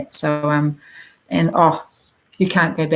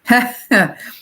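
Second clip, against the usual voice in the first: brief real chuckle; short laugh; 4 semitones higher, 3 dB louder than usual. Real, not acted. Reader turning up one more card, short laugh.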